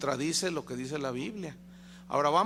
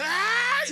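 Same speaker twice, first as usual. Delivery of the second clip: high hammed-up battle cry of an exorcist; a sudden, theatrical "¡Ah!" from the preacher; mock exorcist cry; 6 dB louder than usual. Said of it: "La pose del liberador".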